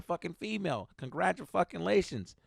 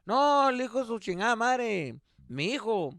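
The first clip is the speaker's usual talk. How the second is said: raised-pitch comic neighbor voice; paisa neighbor venting; 5 semitones higher than usual. Retold or imitated. Paisa neighbor venting to dad about his son, higher-pitched. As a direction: complain on and on in a higher voice. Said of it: "the guy's just like".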